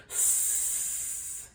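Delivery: long voiceless hiss; snake hiss. A narrator's reading. Snake threatening the classroom, a long hiss. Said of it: "the snake said".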